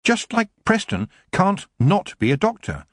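high, mildly upset voice; sad. A narrator's reading. Young witch compares herself to her friend, sad. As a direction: finish the plea with understated sadness. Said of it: "she finished sadly".

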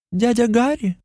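high, uncertain call into the room; calling out uncle. Acted. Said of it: "Морган окликает его".

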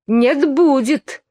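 smug retort. A narrator's reading. Twin contradicting Alice, smugly drawn out. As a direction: draw it out, pleased with yourself. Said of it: "самодовольно возразил".

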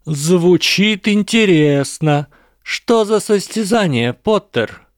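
high, drawn-out public challenge; loud drawl. A narrator's reading. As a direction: call it out with stretched words. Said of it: "растягивать слова можно так громко". (громко, нараспев, с вызовом)

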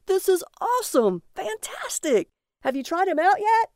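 high, giddy excitement; childish enthusiasm. Acted. Barry to his wife about the old man, giddy and high. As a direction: gush like a thrilled big kid.

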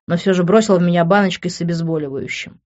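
ordinary delivery, nothing marked

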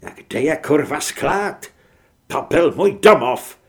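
loud rising rage ending in a bellow; a man yelling about his destroyed home; roaring. Acted. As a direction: scream out the loss of his home. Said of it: "zařval Nikolaj".

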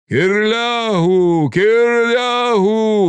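long drawn-out high calls, climbing; slow, high-pitched chanted call; crowd calling out. A narrator's reading. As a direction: stretch the name out as a rallying cry. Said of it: "гукали деякі".

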